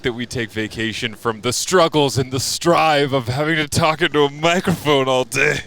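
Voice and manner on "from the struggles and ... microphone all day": hammed-up lament, louder and higher as it builds; mock melodramatic complaint. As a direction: play the overworked martyr for laughs. Mock complaint about broadcasters' hard work.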